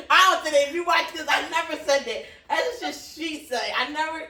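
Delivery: loud, laughing denial; loud protest. Real, not acted. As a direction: protest loudly through laughter.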